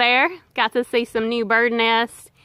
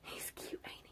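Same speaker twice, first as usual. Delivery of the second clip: whispering; 31 dB quieter than usual. Real, not acted. Whispering near a wild animal.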